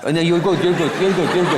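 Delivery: nervous laughing reassurance to the guest; laughing backpedal. Real, not acted.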